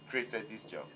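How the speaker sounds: usual speaking voice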